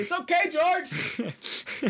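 high, long-drawn distant call; retold yelling. Canvasser to departing student, high drawn-out mock yell. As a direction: imitate hollering after someone, stretching the words. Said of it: "he kept yelling".

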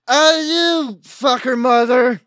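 mock angry shout. Guide angrily confronts the ex.

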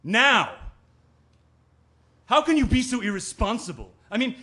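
loud, high angry tirade; outraged scolding at full voice; furious. Acted. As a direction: let the anger burst out and build. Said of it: "I'm so mad".

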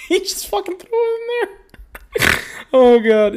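squealing laugh through the words; breaking up laughing, high; real giggling fit. Real, not acted. Cracking up over the bio.

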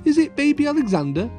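put-on high, quick child question; curious little girl. A narrator's reading. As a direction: ask eagerly like a small child. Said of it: "she asked".

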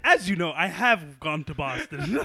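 mock the stiff reporter tone; news reporter voice. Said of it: "You sound like a news reporter".

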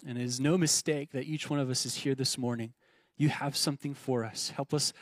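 low, softened praying voice; prayer. Real, not acted. Pastor leading the congregation in morning prayer, low and subdued. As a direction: talk humbly and gently to God.

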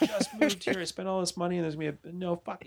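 hammed-up high voice of a woman losing it; mock frantic woman. Woman told a bear bridge goes over her house, mock frantic. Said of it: "one woman just lost her fucking mind".

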